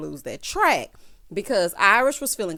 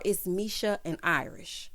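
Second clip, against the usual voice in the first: ordinary talk, nothing marked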